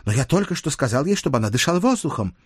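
put-on protesting young page. Page objects to fetching milady, protesting.

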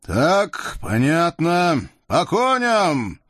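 slow, high-pitched command building to a call; commanding Cossack chief. A narrator's reading. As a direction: call the men to horse. Ataman orders Cossacks to mount.

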